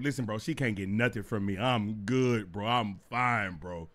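low, softened, settling refusal; calm reassurance. Retold or imitated. Telling his bro he's fine, calm reassurance. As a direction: turn it down gently and firmly.

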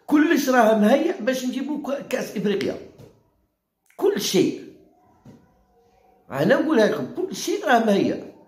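high mocking voice, everything's fine, sure; mock the officials in a sing-song high pitch; sarcastic high-pitched parody of official reassurance; sarcastic mockery. Mocking official claims that all's ready.

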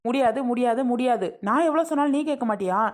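high, insistent quarrelling voice; exasperated daughter arguing back; heated refusal. Acted. Acted quarrel, repeated refusal.

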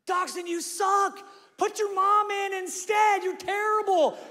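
high, loud, jeering heckler; drunk heckler yell. Retold or imitated. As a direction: taunt the quarterback from the sidelines. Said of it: "that's all I heard".

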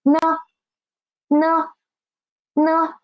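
呢 said short and light in the neutral tone; soft quick neutral tone. Shown as a demonstration. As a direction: clip 呢 briefly and unstressed, like a particle. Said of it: "pronounce it soft and quick".

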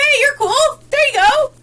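high, loud cheerful call; hammed-up party shout; party greeting. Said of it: "I'd be like".

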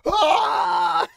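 ordinary talk, nothing marked

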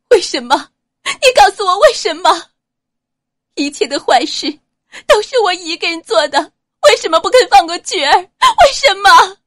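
high, loud crying demand, slowing on the last why; sobbing shout. A narrator's reading. Ouyang Nuan begging Xiao Chonghua for answers, shouting through sobs. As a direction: break into tears while screaming the questions. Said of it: "欧阳暖泪如雨下".

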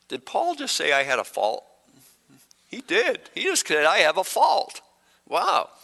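high-pitched, comically affronted surprise; playfully stunned, voice leaping high; mock disbelief. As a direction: act scandalised by the accusation, pitch jumping up. Mock shock at being called faulty.

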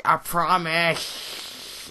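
very slow, stretched promise; drawn out. Real, not acted. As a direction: drag out every word. Vows again, drawn out.

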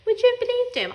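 indignant. A narrator's reading. Cat's indignant aside.

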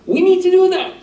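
rousing call. Real, not acted. Preacher urging congregation to act.

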